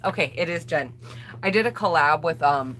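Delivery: ordinary talk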